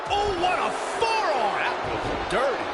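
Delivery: very high excited outburst; wrestling commentator. Real, not acted. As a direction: shout the big hit in awe. Live play-by-play of the match.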